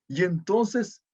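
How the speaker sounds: usual speaking voice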